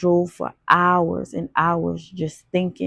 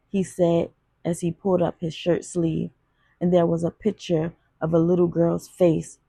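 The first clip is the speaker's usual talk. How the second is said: usual speaking voice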